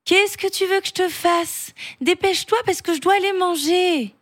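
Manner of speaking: put-on a kid who wants to go eat; lightly hurried young boy; impatient boy. Julien impatient.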